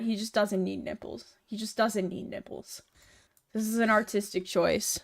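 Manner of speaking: normal talking voice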